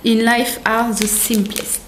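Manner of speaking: slow English in a heavy French accent. Real, not acted. Vlogger reads a notebook cover, French-accented English. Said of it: "mon accent anglais, bonjour".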